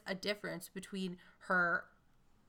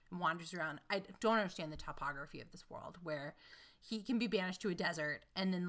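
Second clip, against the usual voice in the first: usual speaking voice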